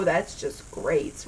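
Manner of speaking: low, slow deadpan sarcasm; sarcastic. Real, not acted. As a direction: drag it out dryly. Sarcastic aside about nausea.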